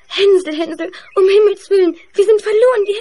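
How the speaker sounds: lauter, verzweifelter Angstruf; panisch. Acted. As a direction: schrei voller Angst, dass alles verloren ist. Gretel in Panik. (Gretel ruft Hänsel verzweifelt in Panik zu)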